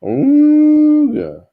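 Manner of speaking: loud, high honking Ooga; a goofy squeezed-bagpipe honk. Acted. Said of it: "and the bagpipe said".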